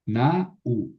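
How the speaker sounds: "na u" as two separate syllables; broken diphthong. Shown as a demonstration. Teacher shows a Greek form, "na u" with diphthong broken. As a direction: pull "na u" apart, vowel by vowel. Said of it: "Aquí también rompo el diptongo".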